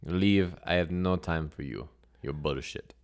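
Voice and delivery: put-on terse accented strongwoman, firm at first; blunt, accented dismissal; dismissive strong woman. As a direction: send him away as a foreign strongwoman.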